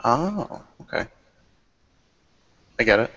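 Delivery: usual speaking voice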